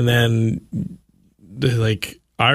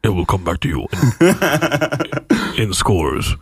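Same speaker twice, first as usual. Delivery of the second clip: deep, solemn voice of God; a grand promise; 4 semitones lower than usual. Pretend. God speaking.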